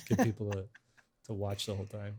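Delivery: ordinary talk, nothing marked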